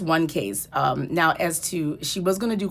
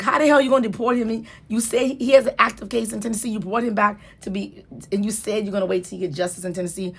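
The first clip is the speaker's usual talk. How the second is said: retold indignant outburst, louder and higher at first; exasperated judge; 5 semitones higher, 5 dB louder than usual. Judge confronting officials over the deportation plan, retold with exasperation. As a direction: challenge them hotly, as the quoted judge. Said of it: "the judge was like".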